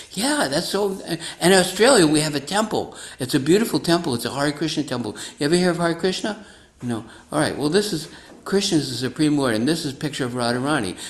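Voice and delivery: lightly voiced enthusiastic devotee; eager preacher. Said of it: "the lady's like".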